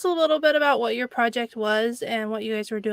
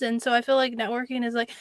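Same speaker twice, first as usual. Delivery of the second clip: ordinary delivery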